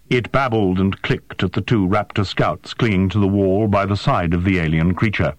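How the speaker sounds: ordinary delivery, nothing marked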